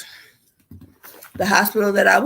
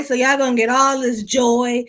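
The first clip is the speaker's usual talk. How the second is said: normal talking voice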